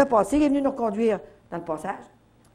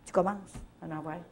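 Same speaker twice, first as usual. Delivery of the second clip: usual speaking voice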